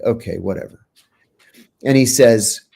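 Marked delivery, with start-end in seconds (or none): 0.0-0.9 dismissive. Retold or imitated. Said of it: "I was like".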